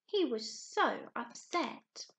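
ordinary talk, nothing marked

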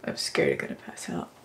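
frightened hushed whisper; scared whisper. Real, not acted. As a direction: breathe it out in fear. Scared before packing removal.

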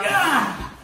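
loud, high gasp of effort; straining yell on the last heave; exertion cry. Real, not acted. Climbing stairs with the bed.

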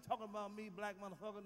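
normal talking voice

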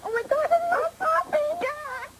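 excited. Real, not acted. Balloons in suitcase won't pop.

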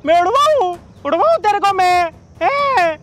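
mocking taunt. Mocking girl, taunting her to fly.

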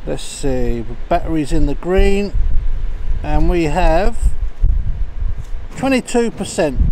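muffled through a helmet visor; talking from inside a bike helmet; inside a motorcycle helmet. Real, not acted. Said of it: "you have to excuse the sound, I've got the helmet on".

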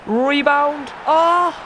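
high, loud yell at the rebound; excited shout. Real, not acted. Gamer yelling at a rebound, high and loud. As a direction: shout it as the ball goes in.

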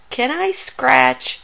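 ordinary delivery

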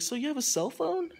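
high-pitched imitation of a nosy stranger; imitated stranger. Stranger quizzing the storyteller about a phone, imitated high questioning.

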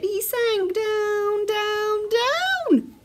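high, slow, stretched storytelling; drawn-out sinking. A narrator's reading. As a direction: stretch each word as if sinking. Acting out sinking into cushion.

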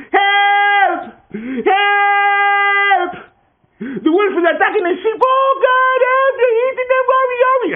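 very high, loud frantic screaming; yelling for help. Acted. Boy screaming to the village again, frantic shriek. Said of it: "yelled down to the village".

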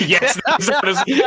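laughing yes. Real, not acted. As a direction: confirm it while cracking up. Laughing while confirming rules.

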